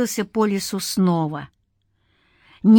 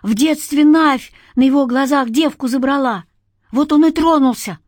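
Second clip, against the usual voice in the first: high, pressing explanation; broken-voiced excuse; 6 semitones higher, 5 dB louder than usual. A narrator's reading. Villager explaining away the fool's madness, broken-voiced. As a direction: explain it away in a raised, strained voice. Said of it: "заговорил сорванным голосом".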